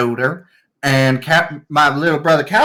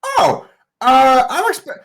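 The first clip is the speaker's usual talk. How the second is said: high, bright-eyed royal voice, much higher; a spoiled young king gushing at his servants; gushing boy king; 11 semitones higher than usual. Acted. Young king gushes over his servants, high and eager. King NPC confides plan.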